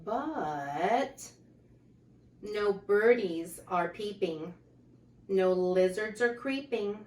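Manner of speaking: lively, sing-song picture book reading; reading to children. A narrator's reading. Reading a rhyming book aloud to children, lively. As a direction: read the rhymes out brightly to the kids.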